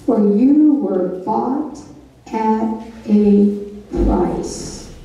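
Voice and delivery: slow, weighty, pausing before price; key verse emphasis. Real, not acted. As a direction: let each word land. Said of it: "Here comes the key verse".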